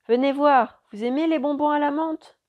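high, loud friendly call; shouting. A narrator's reading. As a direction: call them over loudly. Pilot shouts over the engine.

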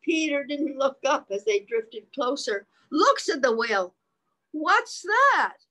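put-on lively read-aloud voice for children; reading the book to little listeners; story narration. A narrator's reading. Parent reading a picture book to children, lively read-aloud voice.

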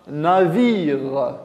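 "navire" stretched out, with a long vi; drawn-out example word. Shown as a demonstration. Teacher gives pupils another word with vi, drawn out. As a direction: hold the vi in "navire". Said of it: "La syllabe vi comme".

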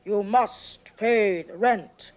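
low, slow, menacing villain; mustache twirling villain. Acted. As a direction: growl it like a melodrama villain. Play with villain and damsel.

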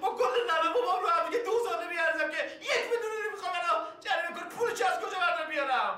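high and fast, laughing in despair; desperate protest. Acted. Desperate protest about fine. (با التماس و خندهٔ عصبی و بلند)

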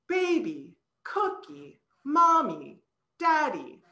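put-on BAby, COOkie, MOmmy, DAddy with the first syllable punched loud and high; sing-song baby words, hitting the front syllable; strong-weak stress on each word. Shown as a demonstration. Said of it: "You're louder, you're longer, you're higher pitched".

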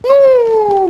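sharp loud cry of alarm; sudden shout. Real, not acted. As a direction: blurt it out loud. Teammate downed mid-fight.

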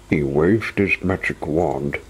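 ordinary delivery, nothing marked

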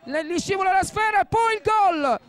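excited commentary. Real, not acted. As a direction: shout it as the ball slips in. Goal after goalkeeper error.

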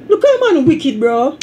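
high-pitched teasing cry of disbelief; mock-shocked exclamation. Real, not acted.

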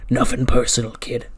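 loud villain promo, easing on the last word; menacing sign-off. Retold or imitated. Wrestler signing off to younger rival, loud mock menace. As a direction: throw it out as a parting threat. End of acted wrestling challenge.

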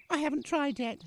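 grave little-child voice, lightly mimicked; solemn. Father retelling to daughter her childhood answer, mock solemn child.

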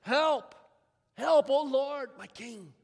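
loud, high desperate cry for help; cried out for help. A narrator's reading. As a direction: call out to the king in panic. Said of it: "a woman cried out to him".

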